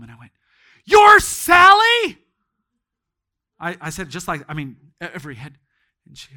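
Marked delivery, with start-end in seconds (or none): 0.8-2.2 sudden loud shout, drawn out slowly; astonished shout. Retold or imitated. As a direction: shout out in disbelief. Preacher realizes who she is.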